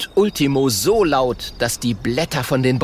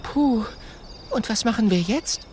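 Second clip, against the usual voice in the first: put-on high girl voice, sighing; 11 semitones higher than usual. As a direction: sigh helplessly. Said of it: "seufzt Mathilda leise".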